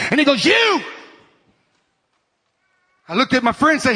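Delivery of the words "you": high mock preacher's yell; retold screaming preacher. Camp preacher singling him out from the pulpit, mock yell. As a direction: call it out high, imitating the preacher. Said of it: "got to screaming and got to going".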